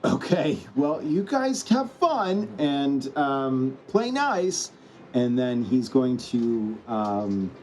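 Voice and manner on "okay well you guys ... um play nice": high-pitched, drawn-out hero farewell; Spider-Man signing off. Acted. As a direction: toss a high, singsong goodbye to the brawlers. Answers the Hulk as Spider-Man.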